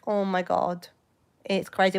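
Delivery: ordinary delivery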